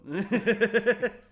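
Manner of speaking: loud, high peal of laughter; big laugh. Real, not acted. Cracking up at the co-host's door jibe, loud laugh.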